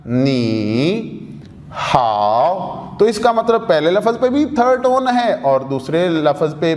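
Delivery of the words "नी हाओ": नी and हाओ each said with a low dipping tone; separate third tones. Shown as a demonstration. Teacher saying नी हाओ as two separate syllables, both low dipping tones. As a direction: give नी and हाओ each a full low falling-rising tone, separated. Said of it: "अलग-अलग".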